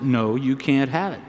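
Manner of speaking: normal talking voice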